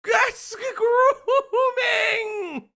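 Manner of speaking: slow, high shouting through laughter; laughing shout. Real, not acted. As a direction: shriek it, half laughing. Outraged laughing repetition.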